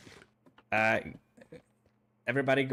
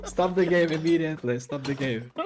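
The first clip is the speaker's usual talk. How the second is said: laughing; 4 semitones higher, 5 dB louder than usual. Real, not acted. Game trap fails, host laughing.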